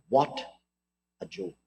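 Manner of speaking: a hint of condescending dismissal; mock condescension. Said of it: "a lofty air of condescension".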